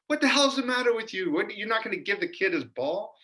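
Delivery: high-pitched mock yell of an angry dad; indignant dad impression, high and strained; imitated yelling. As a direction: voice an outraged dad, pitch shooting up without much volume. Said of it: "starts yelling at the woman".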